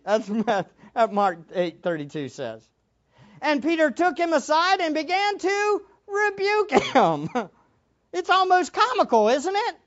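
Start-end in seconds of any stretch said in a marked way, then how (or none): none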